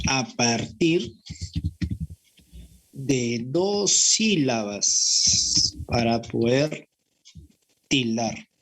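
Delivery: very slow, spaced-out teaching; slow and deliberate. Real, not acted. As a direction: draw out each phrase with long pauses. Teacher spells out a key rule. (explicando muy despacio, con pausas)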